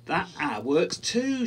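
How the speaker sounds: mild storybook shout, slow and high; excited shout. A narrator's reading. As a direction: call it out in happy discovery. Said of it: "shouted Quentin".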